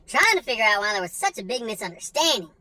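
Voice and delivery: normal talking voice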